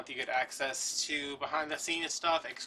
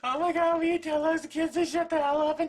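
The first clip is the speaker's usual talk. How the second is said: much higher and louder, hammed-up yelling; mock exasperated shout; 13 semitones higher, 10 dB louder than usual. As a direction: scream at the friend over the noisy kids.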